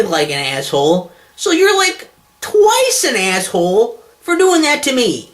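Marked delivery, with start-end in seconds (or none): 1.3-5.3 exasperated high rant. Real, not acted. Ranting at thank-you videos.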